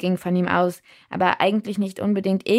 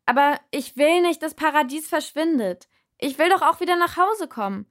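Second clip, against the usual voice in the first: higher, louder talk throughout; shouted into ear; 9 semitones higher, 4 dB louder than usual. A narrator's reading. Narrator explaining herself into the giant's ear, shouting. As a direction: shout the story into his ear. Pleading over loud music.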